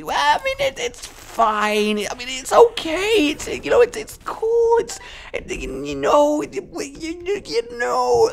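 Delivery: nervous falsetto excuse; high squeaky voice. Acted. Defendant squeaks "it's fine.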